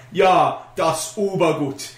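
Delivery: very slow mock-German accent; drawn-out, badly faked German; fake German. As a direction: pretend fluency in German, word by word. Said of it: "sound like I'm speaking German".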